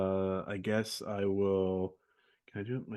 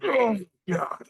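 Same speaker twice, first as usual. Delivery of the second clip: a high, startled "oh God" of dismay; a panicked guard catching sight of the fire; high mock dismay; 10 semitones higher, 4 dB louder than usual. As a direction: gasp it out, pitched way up. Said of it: "just, like".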